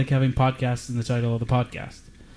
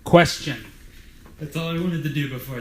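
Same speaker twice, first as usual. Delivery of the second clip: Dwight impression; 3 semitones higher than usual. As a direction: bark it out like Dwight Schrute. Dressed as Dwight, asks question.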